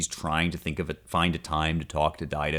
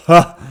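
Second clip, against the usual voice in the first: bright burst of laughter; 12 semitones higher, 14 dB louder than usual. Real, not acted. Delighted by the passage.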